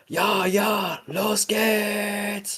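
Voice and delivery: high-pitched mock German war cry; imitated German yelling. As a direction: yell like a German soldier, high, last word drawn out. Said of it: "the sound of a German yelling".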